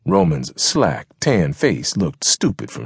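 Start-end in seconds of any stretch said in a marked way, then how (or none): none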